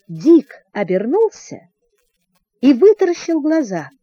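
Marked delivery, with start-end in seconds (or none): none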